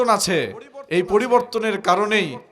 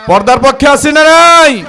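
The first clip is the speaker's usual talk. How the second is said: loud, high rallying shout; shouting to the crowd; 6 semitones higher, 12 dB louder than usual. Real, not acted. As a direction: demand an answer from the crowd at full voice. Preacher calls to crowd.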